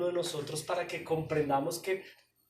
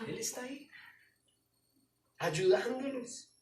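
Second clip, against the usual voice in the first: reassuring; 7 semitones higher, 5 dB louder than usual. Acted. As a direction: lift them gently. Pastor reassures slowly.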